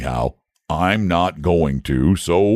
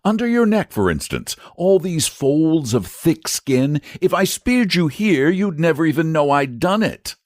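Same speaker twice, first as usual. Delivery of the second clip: Saint George, coaxing; 13 semitones higher than usual. A narrator's reading. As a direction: soothe the nervous dragon. George points to a spot.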